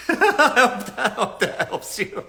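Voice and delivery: giggling through the words; breaking up laughing at own advice. Real, not acted. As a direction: laugh while trying to say it.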